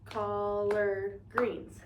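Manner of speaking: drawn-out teaching model; slow syllable count. Shown as a demonstration. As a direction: stretch every syllable for the class. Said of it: "So this is two words".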